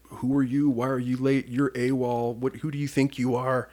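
hammed-up interrogation of a late recruit; retold drill instructor tearing into a recruit; mock drill instructor yell.

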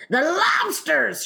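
high, drawn-out excited shout. Acted. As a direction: burst out with a high holler. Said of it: "shouted the Gryphon".

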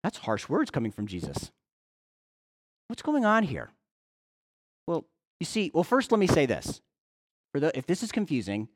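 usual speaking voice

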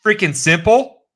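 mock outrage, drawn out; outraged retort. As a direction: blast the insult in outrage.

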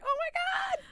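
very high mock squeal; imitating a scream. Cohost reenacting texting screams, mock squeal. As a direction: squeal like getting the notification. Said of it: "screaming when we got the notification".

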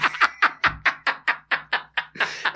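long, high breathless laughter; helpless airy wheeze of laughter; wheezing laugh. Real, not acted. Storyteller laughing at getting beaten up, high wheezy laugh.

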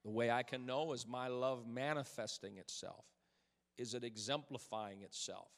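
low, soft and spaced; soft intimate preaching. Acted. Pastor quietly pressing his message home to church. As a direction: lean in and speak gently.

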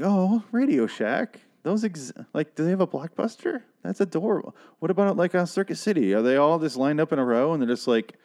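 put-on high sing-song talk as if to a toddler; mock condescending cooing. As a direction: coo at it like a cute relic. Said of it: "I was like".

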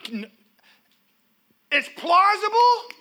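ordinary talk, nothing marked

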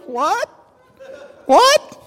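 high-pitched mock disbelief; incredulous what. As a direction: blurt it in rising, astonished repeats. Reacting to soldiers with bumper stickers.

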